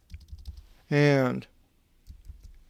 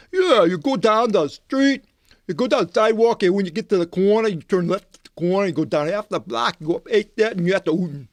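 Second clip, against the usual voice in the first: imitating a fast-talking Boston guard, pitched higher; Boston local accent; 5 semitones higher than usual. Gate guard rattles off directions to the library, imitated Boston accent.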